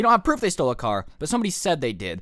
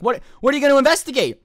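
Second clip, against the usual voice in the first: much higher and louder, fast, outraged; indignant outburst; 10 semitones higher, 8 dB louder, faster than usual. Retold or imitated. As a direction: fire it back at the dean in disbelief. Retold arguing with the dean.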